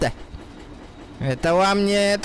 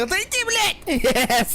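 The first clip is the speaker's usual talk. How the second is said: angry yell; 9 semitones higher, much slower than usual. Real, not acted. Yelling at a teammate.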